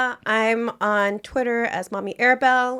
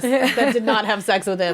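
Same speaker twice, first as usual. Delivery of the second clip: laughing. Real, not acted. Laughing while recalling the trend.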